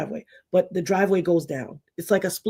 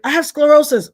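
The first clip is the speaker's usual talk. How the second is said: higher, louder urgent announcement; insistent child; 8 semitones higher, 7 dB louder than usual. Retold or imitated. Girl tells mother she has sclerosis, high and urgent. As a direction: burst in insisting.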